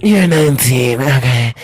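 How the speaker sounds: mimicking caller. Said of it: "I just mimicked your voice".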